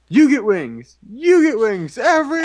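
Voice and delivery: loud, high excited giveaway host; Oprah. Retold or imitated. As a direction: shout it like a TV host giving prizes. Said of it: "Oprah guest stars".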